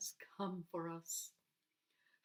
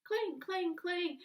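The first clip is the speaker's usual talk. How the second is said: clanging sound; 10 semitones higher, 4 dB louder, slower than usual. A narrator's reading. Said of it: "banging them together".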